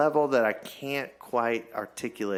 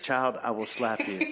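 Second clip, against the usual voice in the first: laughing threat. Retold or imitated. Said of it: "I said".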